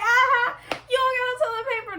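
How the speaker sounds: high, jeering and quick; mocking schoolgirl taunt. Mocking the girl's version of the taunt, high and fast. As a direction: taunt like a playground bully. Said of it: "That's how she was explaining it".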